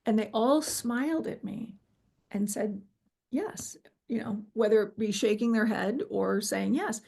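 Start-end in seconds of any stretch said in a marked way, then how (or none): 3.3-3.8 whispered yes. Retold or imitated. Heavenly figures answering yes softly.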